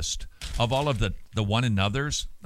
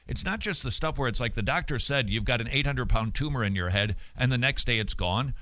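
ordinary talk, nothing marked

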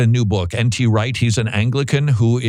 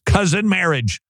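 Cousin marriage repeated higher, stressed on cousin in disbelief; raised-pitch Cousin marriage, unbelieving; incredulous repeat; 7 semitones higher than usual. Real, not acted. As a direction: echo it back in disbelief, Cousin hit high. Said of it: "repeats with disbelief".

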